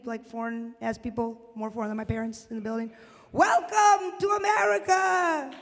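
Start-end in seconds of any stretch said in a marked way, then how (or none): 3.3-5.5 high, slow shout of joy; excited shout. Retold or imitated. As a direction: cheer it like a proud new immigrant. Mimicking immigrant parents' excitement.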